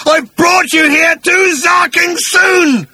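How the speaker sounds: very high, slow, loud scream; screaming bellow. Acted. Agrajag cursing his own mistake, screaming bellow. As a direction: bellow it out, stretching each word. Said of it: "He started to scream and bellow".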